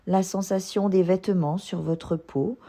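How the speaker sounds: ordinary delivery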